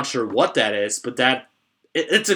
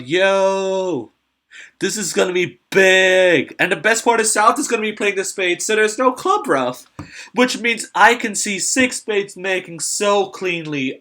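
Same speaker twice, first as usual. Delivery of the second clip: excited; 7 semitones higher, 4 dB louder than usual. Real, not acted. Hyped commentary on slam.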